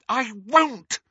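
high, loud refusal; defiant shout. A narrator's reading. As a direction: refuse hotly, pitched right up. Daughter defies shouting mother in quarrel.

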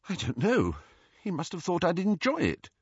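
tearful. A narrator's reading. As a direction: answer as if holding back crying. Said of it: "mopping her eyes with her sleeve".

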